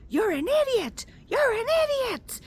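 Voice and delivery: high, loud mock yell at her; angry old man yelling. Grumpy driver yelling at her from his window, loud mock shout. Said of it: "he was so angry, super angry".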